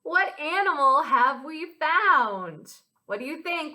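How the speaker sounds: put-on lilting children's rhyme with swooping pitch; rhyme recital. Teacher sharing a nighttime rhyme with children, lilting. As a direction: chant it with playful mystery. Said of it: "this rhyme goes like this".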